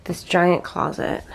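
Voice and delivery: ordinary talk, nothing marked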